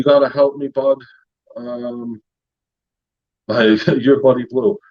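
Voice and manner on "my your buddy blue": ordinary talk, nothing marked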